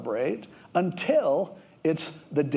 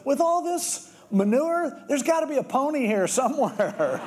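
much higher, gleeful squeal; thrilled kid; 10 semitones higher than usual. Retold or imitated. Kid digging through manure to his dad, gleeful. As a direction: sheer delight at finding a pony. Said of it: "he's, like, so excited".

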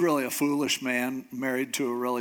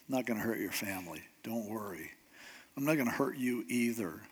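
soft, low, unruffled promise; calm, hushed, matter-of-fact comfort; quiet reassurance; 4 semitones lower, 8 dB quieter, faster than usual. Retold or imitated. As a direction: drop the voice and soothe him. David reassures a pleading Saul.

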